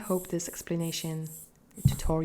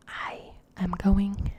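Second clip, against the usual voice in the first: whispering; faster than usual. Acted. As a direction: breathe the goodbye in a whisper. Whispered sign-off, ASMR tease.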